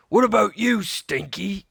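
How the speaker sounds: high, loud roaring question; captain roaring up at his crewman; pirate roar. A narrator's reading. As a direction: bellow it at the crewman. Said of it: "Captain Bones roared".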